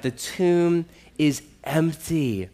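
ordinary talk

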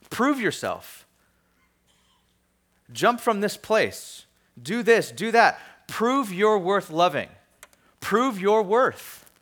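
tempting voices. Acted. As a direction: goad the listener with drawn-out challenges. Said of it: "these temptation voices".

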